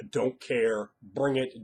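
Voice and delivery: ordinary talk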